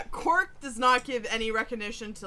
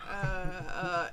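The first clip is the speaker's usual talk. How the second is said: ordinary talk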